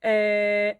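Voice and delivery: usual speaking voice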